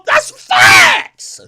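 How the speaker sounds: a high, loud shouted punchline. Real, not acted. Hyping his own roast, a loud high shout. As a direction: yell it up high like a crowd hype. Heated rant roasting a rapper.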